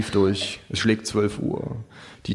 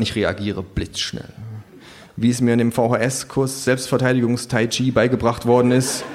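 ordinary delivery

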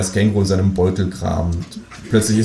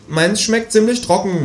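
high, slow sulky kangaroo grumble; grumbling kangaroo; 13 semitones higher than usual. Acted. Kangaroo complaining about its dry dinner, high and sulky. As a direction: moan about the food like a spoiled kangaroo. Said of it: "mault das Känguru".

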